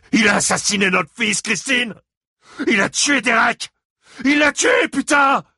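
high, loud raging yell; enraged shouting. A narrator's reading. Father shouts to Christine that Gérald killed their son. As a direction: scream out the accusation.

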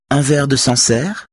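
high, quick lift into a cheery offer; cheerful. A narrator's reading. Hostess offers Saga a glass of Sancerre, cheerful. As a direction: offer it brightly, pitch well up. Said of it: "demande-t-elle d'un ton allègre".

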